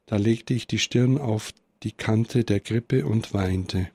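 ordinary delivery

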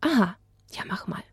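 hushed reply; whisper. Acted. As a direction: whisper back along with the puppet. Adelheid whispers back. (geflüstert)

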